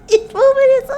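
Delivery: high, loud, struggling not to laugh; stuck restarting as laughter takes over; breaking up laughing. Real, not acted. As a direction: force the words out through laughter. Cracking up while describing the dance.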